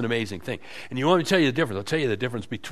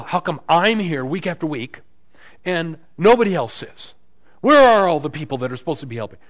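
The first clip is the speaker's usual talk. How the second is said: mock complaint; 5 semitones higher, 9 dB louder than usual. Acted complaint about unfaithful others.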